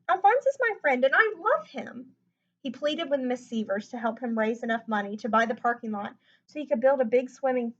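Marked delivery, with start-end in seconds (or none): 0.1-2.2 pleading. A narrator's reading. Lewis pleading for his pet.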